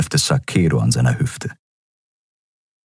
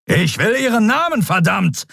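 much higher, thundered demand; thundering. Acted. Admiral demanding the prisoner's name, thundering. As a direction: roar for the name. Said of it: "donnerte Telios".

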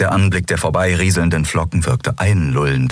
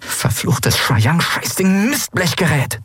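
slow, pitched-up hissed curse at the device; 11 semitones higher, slower than usual. Acted. Endriel curses the artifact, a slow hiss. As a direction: spit the curse at the bracer. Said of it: "zischte Endriel".

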